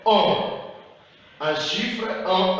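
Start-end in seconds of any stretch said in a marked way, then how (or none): 0.1-0.5 "on" said as a clear nasal vowel; the on sound. Shown as a demonstration. Teacher showing pupils the nasal "on" sound. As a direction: stretch out the nasal "on". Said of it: "C'est le son".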